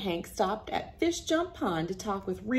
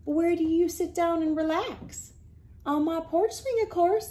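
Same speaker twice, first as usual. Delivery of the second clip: higher exchange; puzzled and obvious; 9 semitones higher than usual. Acted. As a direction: play both characters. Voices exchange.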